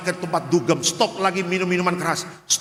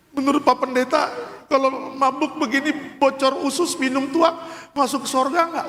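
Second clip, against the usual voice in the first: imitating widow; 9 semitones higher than usual. Widow asks pastor.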